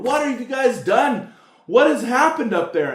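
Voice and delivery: high, loud panicked shouting; acted panic, voice jumping way up; panicked guards. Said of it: "They're panicking. They're going".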